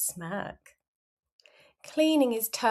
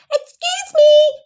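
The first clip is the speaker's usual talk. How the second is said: put-on very high, loud little-kid voice; piercingly high, insistent interruption; interrupting child; 12 dB louder than usual. A narrator's reading. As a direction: butt in like an impatient small child. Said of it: "you say with no time to spare".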